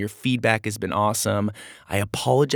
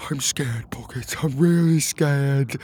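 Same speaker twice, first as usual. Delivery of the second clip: small frightened character voice; scared; 3 semitones higher than usual. Acted. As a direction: confess your fear, drawing out the end. Frightened captive confides in friend.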